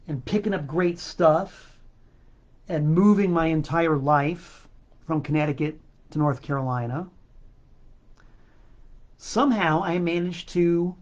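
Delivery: ordinary talk, nothing marked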